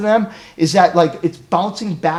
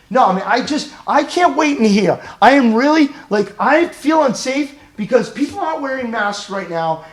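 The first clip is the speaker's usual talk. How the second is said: high, insistent anxious protest; worked-up, pleading objection; acted anxiety; 7 semitones higher, 4 dB louder than usual. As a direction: protest as if mildly panicked. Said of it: "I can see you're really anxious".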